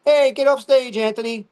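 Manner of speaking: high, loud audience heckle; heckler. Acted. Audience member heckling the comedian on stage, loud and high. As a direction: call out like a heckler in the crowd. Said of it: "one of the audience members like".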